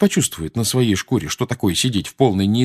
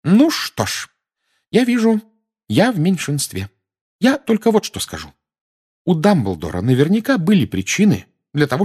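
worried mother standing her ground, voice up; Mrs Weasley; 7 semitones higher than usual. Acted. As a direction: press her point as a stubborn mother. Mrs Weasley argues, high voice.

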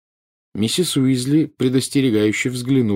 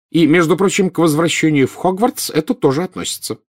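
indignant, high-pitched mother; scolding; 8 semitones higher than usual. A narrator's reading. Mother extends her threat to school, scolding. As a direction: add the warning sharply.

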